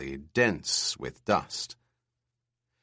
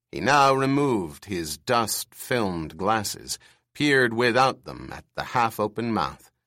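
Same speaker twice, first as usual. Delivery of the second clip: ordinary delivery, nothing marked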